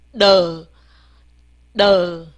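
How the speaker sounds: 的 as a low, loud, voiced "duh"; 整合发音示范. Shown as a demonstration. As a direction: blend t and g into a sinking, held 的. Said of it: "的g整合一下". (低而响地念浊音“的”，拉长下降)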